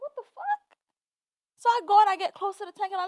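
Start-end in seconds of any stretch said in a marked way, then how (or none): 0.0-0.8 shocked. Retold or imitated. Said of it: "I was like".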